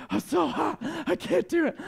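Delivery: high, put-on whiny roommate voice. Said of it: "And he's like".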